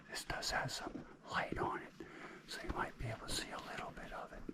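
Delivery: hushed cave whisper. Real, not acted. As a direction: keep it to a whisper, in awe. Whispering through the cave tour.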